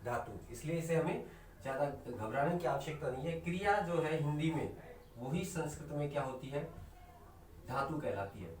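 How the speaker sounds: ordinary talk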